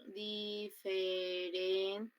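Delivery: very slow, drawn-out syllables in "Diferencias". Shown as a demonstration. Teacher counting syllables for the class, syllable by syllable.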